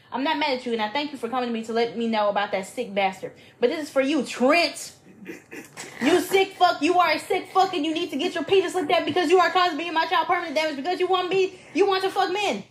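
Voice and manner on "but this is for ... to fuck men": high, raised-voice tirade reading an angry letter; outraged, high-pitched telling-off; screaming rant. Acted. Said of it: "Blue started screaming".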